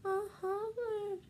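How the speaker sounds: slow, high whimpering beg; fake-cry it like a hungry kid; whiny fake cry. Kid begs sibling for food, fake crying.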